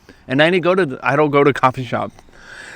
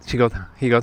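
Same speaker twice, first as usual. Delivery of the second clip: ordinary delivery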